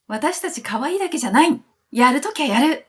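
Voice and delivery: high, punchy imitated boast; defiant declaration. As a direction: play the group insisting they mean business, pitch up. Voicing the group's bold declaration.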